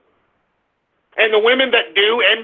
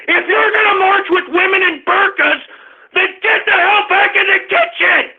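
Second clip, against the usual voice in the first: furious shout; 9 semitones higher than usual. Real, not acted. Angry host yelling at women marchers to go back, furious shout. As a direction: bark the order at them in a rage. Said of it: "I'm just pissed off!".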